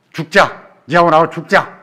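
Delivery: mother snapping back at her crying daughter, loud; mock despairing outburst. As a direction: shout it like a mother at her wits' end. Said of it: "이러십니까?".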